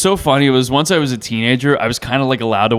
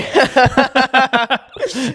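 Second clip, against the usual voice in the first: high burst of real laughter; laughing; 12 semitones higher than usual. Real, not acted. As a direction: crack up. Laughing after impression.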